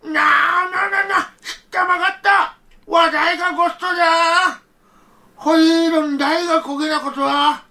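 overwhelmed with wonder; old man stunned by the gifts; amazed grandfather. Acted.

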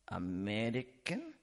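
slow, low question rising at the end; suspicious cab driver. Retold or imitated. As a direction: imitate a foreign driver's wary one-word question. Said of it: "looks at me sideways and goes".

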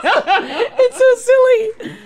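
high, slow laughing. Real, not acted. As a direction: say it through giggles. Laughing at the old username.

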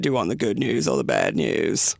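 deep hoarse demon growl. Pretend. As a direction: rasp it like a demon. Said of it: "like, a demon voice".

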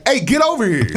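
half-laughed demand; mock summons. Shown as a demonstration. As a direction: play it as a teasing call-over. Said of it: "or it could be like".

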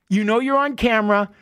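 usual speaking voice

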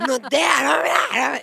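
light imitation of girlish screams; imitated sweet screaming. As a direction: mimic an overexcited girl's disbelief. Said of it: "צרחות הכי מתוקות".

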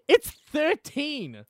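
slow, high, amazed reveal; surprised. Real, not acted. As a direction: draw it out in disbelief. Reveals surprising pod strength.

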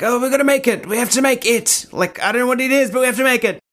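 high-pitched frantic insistence; high anxious voice. Retold or imitated. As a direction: squeak it out like a nervy drama student. Anxious drama students voiced.

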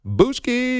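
high mock squeak; high squeaky. Imitating the drop.

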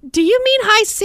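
high pitched "you can't be serious"; incredulous mom. Retold or imitated. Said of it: "I said".

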